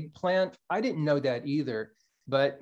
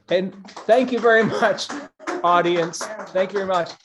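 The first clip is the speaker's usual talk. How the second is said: loud thanks; 4 semitones higher, 5 dB louder than usual. Real, not acted. Thanking audience after poem.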